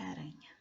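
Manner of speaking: ARANHA softly, with a light tapped R; quiet aranha with one flick of the tongue for R; single-R sound. Shown as a demonstration. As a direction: ask it softly, tapping the R in aranha. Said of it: "Será que aranha é com R".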